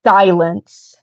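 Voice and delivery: clean, bold order; commanding but controlled one-word order. Acted. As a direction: deliver it firmly without yelling. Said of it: "a clean, bold silence".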